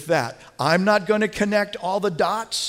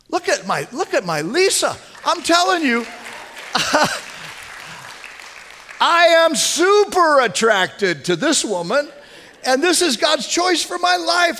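excited; 8 semitones higher than usual. Real, not acted. Boasting happily about his wife.

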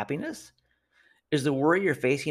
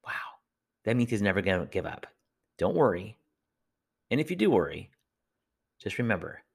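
normal talking voice

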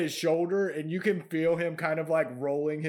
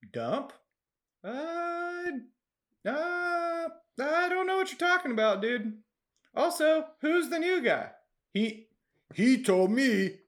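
nervous goblin; 11 semitones higher than usual. Acted.

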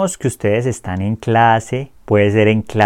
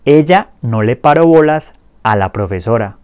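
clear repeat-after-me drill. Shown as a demonstration. Teacher drills the conjugations for students to repeat, clear and steady. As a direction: say each line clearly for learners to echo. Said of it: "Repitan después de mí".

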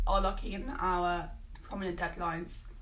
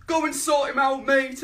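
lightly voiced tough gangster, louder; gang member ordering; 2 semitones higher, 9 dB louder than usual. Shelby ordering the worst Shelby, mock tough order. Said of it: "Someone would be like".

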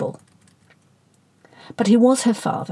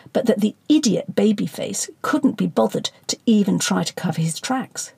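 ordinary delivery, nothing marked

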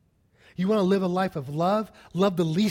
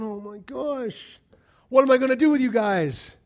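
exasperated; 9 semitones higher, 6 dB louder than usual. Retold or imitated. Paul fed up with the squabbling church, exasperated. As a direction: wail it in despair at them. Paul's exasperated reply, acted.

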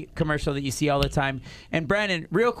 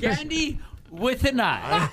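high, slow stage announcement; strip club MC voice; 9 semitones higher, slower than usual. Acted. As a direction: call the dancer out like an emcee. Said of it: "It's turned into a strip club M C".

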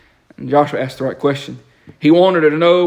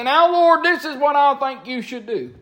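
mock bossy demand; 12 semitones higher than usual. Preacher mimicking a believer bossing God, pushy. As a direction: tell God what to do, hammed up.

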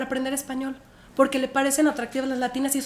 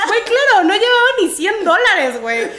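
high, loud laughing telling; 8 semitones higher, 6 dB louder than usual. Real, not acted. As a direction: laugh through the confession. Cuenta riendo su falta de dinero. (contándolo entre carcajadas)

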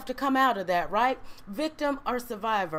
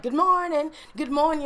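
higher, louder sing-song good morning; chirpy coworker greeting; 5 semitones higher, 5 dB louder than usual. Retold or imitated. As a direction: play the cheery coworker greeting you at the office. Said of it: "and people like".

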